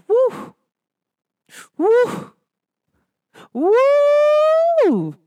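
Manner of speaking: karma's coming, whoop it up; excited woo. Acted. Woos about karma hitting others, rising excited whoops.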